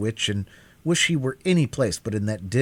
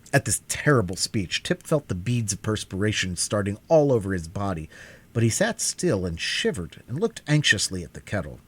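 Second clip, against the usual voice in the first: ordinary delivery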